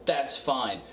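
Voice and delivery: normal talking voice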